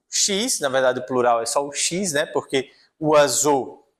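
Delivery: normal talking voice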